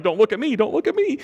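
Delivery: high squeaky imitation of choking up; mock bawling. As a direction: pitch climbs sharply, comically fighting off tears. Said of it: "we're bawling".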